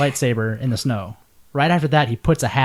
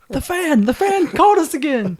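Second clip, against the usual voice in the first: excited. Pretend. Mock excitement over fan.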